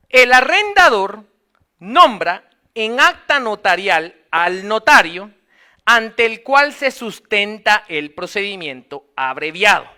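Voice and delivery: slow, emphatic reading for note-takers; measured classroom dictation with firm stresses. Acted. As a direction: dictate it slowly, phrase by phrase. Dictates text to underline.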